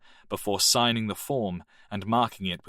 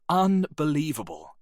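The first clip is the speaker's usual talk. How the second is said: exasperated; 7 semitones higher than usual. Acted. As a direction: let the anger boil over on Unbelievable. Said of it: "Arnold was turning red".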